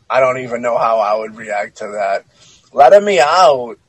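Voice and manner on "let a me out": put-on louder Mario-like Italian accent; sing-out Italian-accented doll line; doll's Italian-accented plea. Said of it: "it's not quite threatening".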